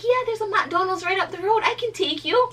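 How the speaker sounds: very high, loud mimicked helper; excited offer. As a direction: squeal it like an eager rescuer. Retells stranger's welcome offer of lift.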